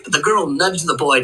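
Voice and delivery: plain reading voice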